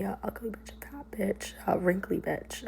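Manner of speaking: whispered list of retold name-calling; hushed insults. Listing boyfriend's insults for viewers, hushed. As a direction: drop to a whisper for each insult. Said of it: "just screaming at me".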